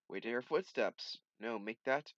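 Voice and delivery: ordinary delivery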